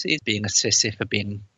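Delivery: ordinary talk, nothing marked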